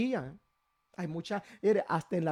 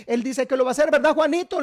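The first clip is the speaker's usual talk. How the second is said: teasing sister; 5 semitones higher, 4 dB louder than usual. Acted.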